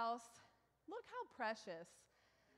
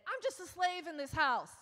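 higher, louder mock exasperated wail; hammed-up martyr of the laundry; a mother at the end of her rope, complaining; overwhelmed complaint; 6 semitones higher, 8 dB louder than usual. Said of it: "when I get, you know, overwhelmed".

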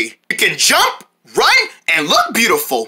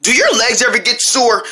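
ordinary talk

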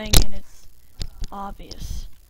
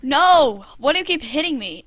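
ordinary talk

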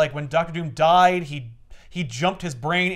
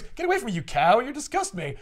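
higher, mock contempt; disgusted rejection; 7 semitones higher than usual. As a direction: shove her away with words. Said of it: "He goes".

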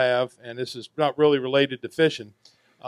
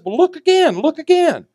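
put-on much higher, pressing old lady; insistent old woman; 8 dB louder than usual. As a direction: squeak it out like a fussy old woman.

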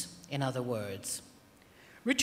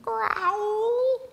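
a much higher little-cub growl; baby bear growl. A narrator's reading. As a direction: squeak out a tiny bear growl. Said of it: "growled the baby bear".

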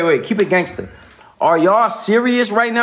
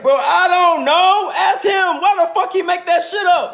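exasperated; 10 semitones higher than usual. Real, not acted. Exasperated ranting at chat.

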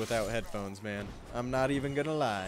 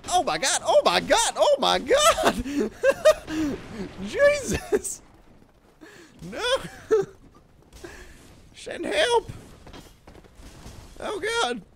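real shrieks, much higher and louder, laughing between; screaming in laughing panic as the enemies swarm; panicked laughing screams; 8 dB louder, slower than usual. Real, not acted.

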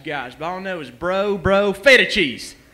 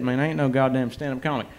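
usual speaking voice

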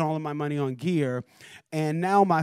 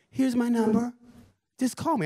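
higher, breezy [name] imitation; [name]'s voice; 7 semitones higher than usual. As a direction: lift the voice like Michael's brother. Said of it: "I keep doing his voice like that".

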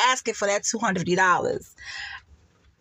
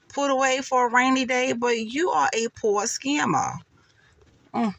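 ordinary talk, nothing marked

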